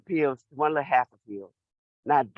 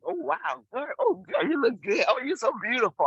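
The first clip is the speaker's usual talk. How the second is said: admiring herself; 7 semitones higher than usual. Retold or imitated. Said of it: "go like".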